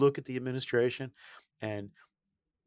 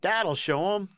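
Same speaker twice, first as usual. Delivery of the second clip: self-satisfied, pitched-up quote; mock the chairman's smugness; smug politician; 8 semitones higher, 5 dB louder, much slower than usual. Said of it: "Chairman Dingell thought".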